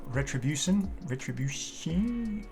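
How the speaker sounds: ordinary talk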